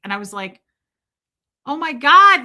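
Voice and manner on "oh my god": loud, high mock outcry; retold exclamation. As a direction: burst out in retold dismay. Retold shock at friend's warning.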